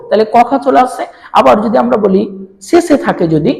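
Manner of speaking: ordinary talk, nothing marked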